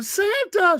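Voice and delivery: very high mimicked squeal; imitating a thrilled wife calling Santa; big-kid excitement. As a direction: call out like an overgrown kid. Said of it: "She's a big kid".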